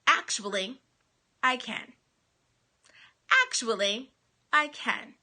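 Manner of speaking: Actually, and I can. set apart by pauses; defiant quote. Shown as a demonstration. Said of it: "you ready?".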